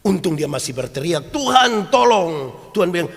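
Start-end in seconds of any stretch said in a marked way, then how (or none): 1.3-2.5 high, loud desperate call; cry for help. Retold or imitated. Sinking Peter crying out to the Lord, a loud, high plea. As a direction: yell it as if you are going under.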